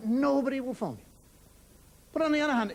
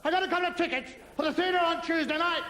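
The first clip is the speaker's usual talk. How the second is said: raised, higher-pitched repeat for a poor listener; speaking up; 4 semitones higher than usual. Acted. Caller asked to speak louder on the phone, speaking up. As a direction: project it to someone who can't hear. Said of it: "speak a little bit louder".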